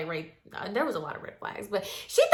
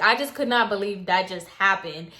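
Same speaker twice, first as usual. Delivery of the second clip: ordinary talk, nothing marked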